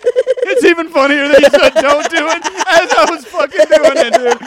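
laughing hard. Real, not acted. Podcaster cracks up at his own bit, laughing. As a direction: talk through a fit of laughter.

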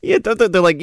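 ordinary talk, nothing marked

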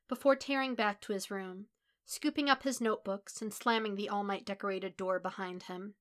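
usual speaking voice

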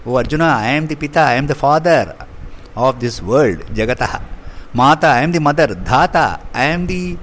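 usual speaking voice

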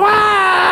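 high shrieking bratty yell; mock child's shriek. Preacher imitating spoiled child to congregation, high shriek. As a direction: squeal like a spoiled ten-year-old. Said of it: "ten-year-old baby going".

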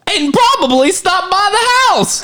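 high goofy clown voice; silly high clown host. Acted. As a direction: play the kids-show clown with a straight face. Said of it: "your clown voice sure is weird".

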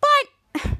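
high, upset yelp; upset protest. Retold or imitated. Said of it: "Piper sounds upset".

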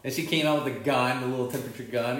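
ordinary delivery

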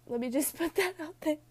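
high, loud words through laughter; laughing at self. Real, not acted. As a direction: laugh at yourself while talking. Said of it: "I do laugh at myself".

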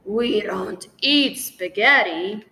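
loud growling reply; growled. Acted. Lion rebuking the student about spaghetti, loud growl. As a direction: growl it out, offended. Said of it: "The lion growled".

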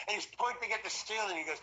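usual speaking voice

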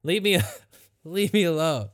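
loud, curt "no" played for laughs; mock grumpy refusal. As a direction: act out brushing off a pest. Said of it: "that would also be my response".

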